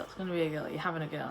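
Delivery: normal talking voice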